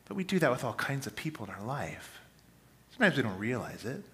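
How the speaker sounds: ordinary talk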